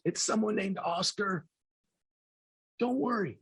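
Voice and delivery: mother calming her dying husband, soft and high. Retold or imitated. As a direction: hush him gently. Mother soothing dying father.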